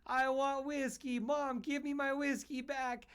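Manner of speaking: imitating a small boy begging his mom; whiny little kid. Dad imitating the neighbor kid begging his mom for whiskey, high whine. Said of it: "And the kid goes".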